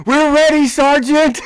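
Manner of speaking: high, loud ready call; retold eager shout. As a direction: shout it out, fired up. Soldiers shouting at sergeant.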